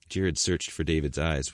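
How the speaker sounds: plain reading voice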